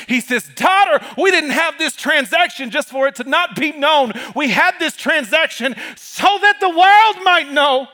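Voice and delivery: high, loud preaching, climbing to a shout; rising Pentecostal sermon cadence; full-force preaching. Acted. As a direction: preach it up to the rafters.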